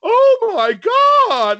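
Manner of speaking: shocked woman, very high, loud and drawn out; high lady voice. Retold or imitated. Imitating a flabbergasted woman at an intersection, high lady voice. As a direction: wail it slowly in a scandalized falsetto. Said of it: "went to that high voice".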